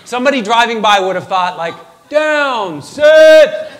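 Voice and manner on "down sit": громкая команда, как собаке; dog command. Acted. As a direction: bark it out like a dog trainer. Imagined dog command from driver's view.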